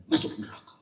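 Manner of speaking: hushed threatening aside; half-whispered mock threat of hell; mock scary warning. Preacher teasing the crowd with a mock hellfire warning, hushed. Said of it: "Allahnya serem".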